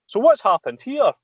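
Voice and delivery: high mock yokel voice; imitating a Fife local. Firefighter retelling a flooded local's question in a high mock voice. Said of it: "one of the wee guys from Fife ... goes".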